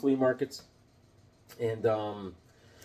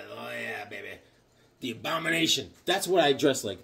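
usual speaking voice

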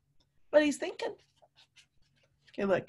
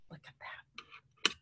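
whisper; 16 dB quieter, slower than usual. Real, not acted. Whispering to the class at the messy picture. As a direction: breathe it, drawing them in close.

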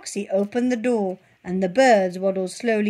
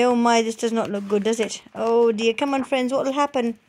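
ordinary delivery

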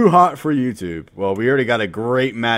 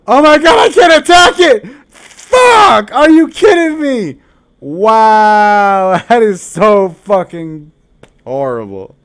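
high, loud disbelief, slower at the end with a laugh; outraged yelling; 10 semitones higher, 12 dB louder, slower than usual. Real, not acted. Streamer raging that he can't attack the card, high loud yell. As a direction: scream it at the screen.

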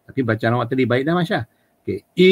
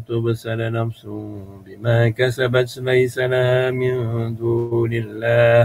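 Quran recitation; 2 semitones higher than usual. Shown as a demonstration. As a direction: recite in the Arabic lilt with clear makhraj.

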